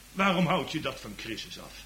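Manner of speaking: normal talking voice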